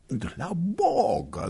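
high, very slow alarmed cry; a hostess startled as the girl runs out; alarmed exclamation. Acted. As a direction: stretch it out in alarm. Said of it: "zawołała pani Stolnikowa".

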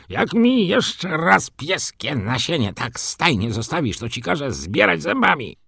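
a stablehand's heated rebuke; angry scolding. A narrator's reading. Stable hand scolding boy.

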